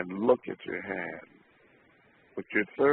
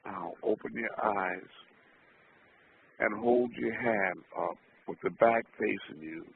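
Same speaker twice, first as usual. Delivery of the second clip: ordinary talk, nothing marked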